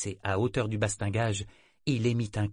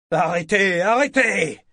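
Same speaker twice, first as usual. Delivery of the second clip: higher, louder hoarse shout; hoarse cry; 9 semitones higher, 9 dB louder than usual. A narrator's reading. Kyle crying out hoarsely to no one. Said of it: "s'écria-t-il d'une voix rauque".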